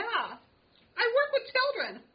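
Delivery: drawn-out high prim claim; high, slow indignant voice. Pretend. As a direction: stretch it out, high and lofty. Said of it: "I will be Aggie".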